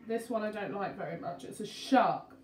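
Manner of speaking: usual speaking voice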